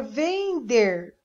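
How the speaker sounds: "vender" split into ven, der; sounding out syllables. Shown as a demonstration. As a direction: say "vender" in two stretched syllables. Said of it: "quero escrever a palavra". ("vender" silabado)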